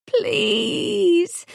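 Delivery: high pleading plea, voice breaking; beg, the voice cracking on the word. A narrator's reading. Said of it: "my voice cracks".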